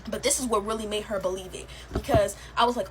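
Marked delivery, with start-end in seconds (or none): none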